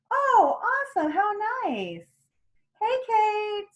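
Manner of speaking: high, sing-song gush; delighted greeting. Acted. Coach acting thrilled that Kate waved, gushing. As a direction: coo with mock delight at being noticed.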